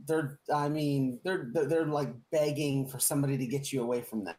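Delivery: normal talking voice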